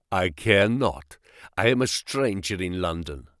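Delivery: low, guarded prisoner voice; defiant captive. A narrator's reading. Captive answering his interrogator, low and defiant. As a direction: answer in a low, deliberate register.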